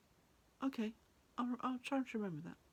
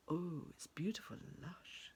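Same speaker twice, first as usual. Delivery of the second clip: slow hushed wonder; awed whisper; 6 semitones lower, 6 dB quieter than usual. A narrator's reading. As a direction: breathe it in awe. Awed at the valley.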